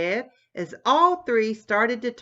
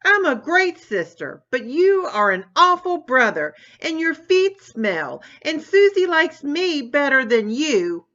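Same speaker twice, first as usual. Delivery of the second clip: angry yelling; 8 semitones higher, 6 dB louder than usual. Acted. Continues the sibling fight.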